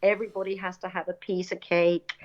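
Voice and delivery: usual speaking voice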